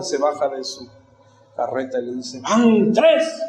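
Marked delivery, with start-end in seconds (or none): 2.4-3.4 loud, raised final warning; ranchero enojado. Acted. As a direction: act out a rancher at the end of his patience. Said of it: "el ranchero se enoja". (advertencia enojada, fuerte y aguda)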